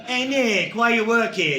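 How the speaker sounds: put-on high, nosy young co-worker; curious backpacker. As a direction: pipe up with blunt curiosity. Backpacker quizzing an older co-worker.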